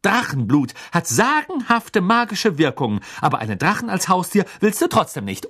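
high, loud eager lecturing; indignant Hermine. A narrator's reading. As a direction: argue the point hotly. Hermine arguing on. (Hermine, hoch und eifrig belehrend)

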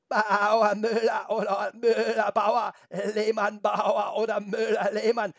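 high, loud, slow goat bleat. A narrator's reading. Goat insists to Felix on its farmer, bleating. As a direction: bleat back with stretched, wobbling vowels. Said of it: "meckerte die Ziege".

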